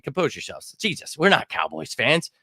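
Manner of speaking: ordinary talk, nothing marked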